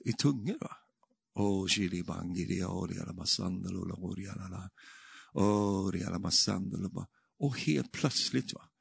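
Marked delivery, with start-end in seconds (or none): 1.4-7.1 low, soft droning chant of tongues; imitated prayer in tongues, kept low; praying in tongues. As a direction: murmur it on one low note, trailing softer. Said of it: "Så började jag be i, i tungor".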